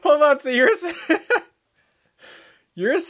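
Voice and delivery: high, loud words broken by laughter; real laughter breaking the question; laughing through words. Real, not acted. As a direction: try to talk while cracking up. Laughing while trying to talk.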